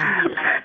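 a breathy unvoiced catch of crying; a sob that slips out like a laugh; choked half-laugh half-sob. Real, not acted. Caller breaking down over her mother, a choked sob.

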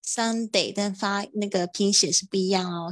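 ordinary delivery

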